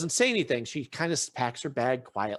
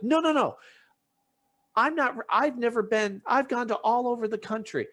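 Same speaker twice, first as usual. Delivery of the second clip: heated denial; 8 semitones higher than usual. Retold or imitated. Woman on reality show interrupting him, heated high denial. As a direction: protest hard and talk over him. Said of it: "and she's like".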